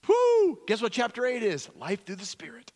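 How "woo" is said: high, loud whoop; excited whoop. Real, not acted. Preacher at the climax, high loud whoop. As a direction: let out a whoop of relief.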